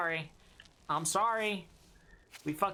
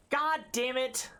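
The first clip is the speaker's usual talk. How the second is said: gasp, then a drawn-out slow curse, higher; frustrated outburst; 5 semitones higher, slower than usual. Real, not acted. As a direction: blurt it out, annoyed, stretching it. Reacting to a milk carton.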